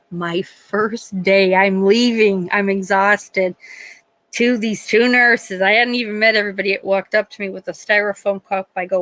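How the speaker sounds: ordinary delivery, nothing marked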